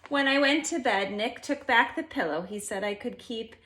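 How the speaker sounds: plain reading voice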